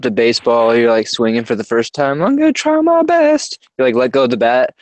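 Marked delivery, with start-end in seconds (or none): 2.2-3.6 very high, goofy beginner voice; hapless try-hard. Retold or imitated. Host mocking a first-time batter at the plate, goofy high voice. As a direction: squeal it like a clueless first-timer.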